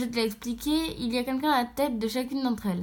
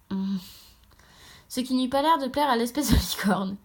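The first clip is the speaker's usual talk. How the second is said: plain reading voice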